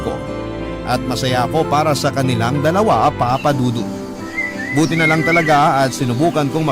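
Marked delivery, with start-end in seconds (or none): none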